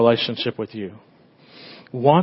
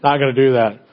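imitated reluctant son. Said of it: "he's like".

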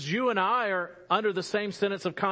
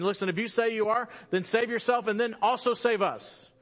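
higher-pitched, acted scoffing challenge; imitating a scoffer, pitched up; 6 semitones higher than usual. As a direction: throw it out as a mock taunt. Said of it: "he's scoffing at him".

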